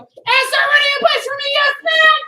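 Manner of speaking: loud, high prompt; energized call. Real, not acted. Instructor starting students running in place, loud and high. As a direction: fire up the class.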